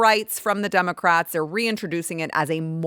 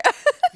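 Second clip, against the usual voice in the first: high laugh; 4 dB louder than usual. Real, not acted. Laugh after singing.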